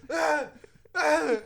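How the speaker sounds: high, open-throated sneeze sound; full-throat mock sneeze. Shown as a demonstration. Friend demonstrating the full-throated sneeze, high mock sneeze. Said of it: "full throat".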